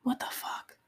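stunned whisper under her breath; whispered shock. Real, not acted. Whispering in shock at her own words. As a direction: whisper it in disbelief. Shocked aside after realizing.

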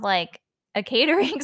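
ordinary delivery

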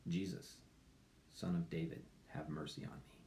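hushed, breathy whisper of a blind beggar; soft whispered prayer; whispered plea. Acted. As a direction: whisper the cry for mercy. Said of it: "Actually, he said".

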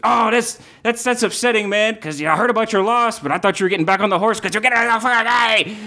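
high, eager hustler voice; squirrel dealer. Acted. Tracksuit dealer greeting the unicorn in a high hustler voice. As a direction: greet him like a sleazy pal. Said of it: "He goes".

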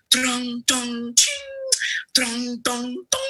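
high sing-song drum and gong noises; imitated drums. Storyteller imitating the emperor's procession, high drum sounds. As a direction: tap out the caravan's music with your voice.

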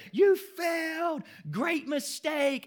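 put-on family cheering the boy's failure, shouting; celebrating shout. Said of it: "They start screaming and clapping".

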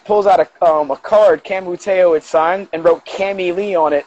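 ordinary talk

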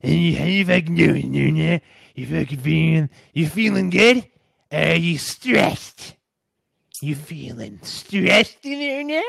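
a lightly voiced imitation of a friend checking in; mild impression of a fast-talking New York pal; imitating Jim. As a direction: ask how they're doing in his buddy's voice. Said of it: "he talks like this".

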